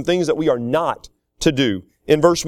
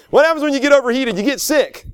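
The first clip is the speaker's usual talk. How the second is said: high, fast, forceful question and answer; raised preacher's voice, pitched well up; emphatic preaching; 8 semitones higher than usual. Real, not acted. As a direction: drive the lesson home from the pulpit. Preacher punches the repeated lesson.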